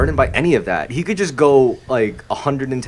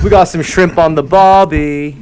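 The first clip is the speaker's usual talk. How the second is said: put-on Australian accent; 5 semitones higher, 8 dB louder than usual. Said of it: "[name], you continue".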